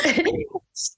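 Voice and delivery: short high laugh trailing up; high giggle. Real, not acted. Still laughing at her own slip, high giggle. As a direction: giggle again at the mistake.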